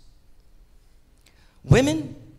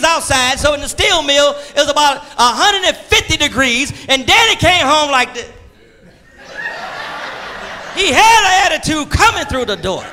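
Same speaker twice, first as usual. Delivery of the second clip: raised preacher's call; preaching; 9 semitones higher, 5 dB louder than usual. Acted. Preacher calling out to the congregation, raised.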